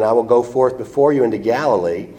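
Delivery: ordinary talk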